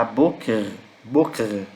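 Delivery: "Boker" ending in a rasped resh; "Boker" falling into a guttural r; throaty final r. Shown as a demonstration. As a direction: say "Boker" as BÔ-kerr, stress first. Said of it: "É um som mais r".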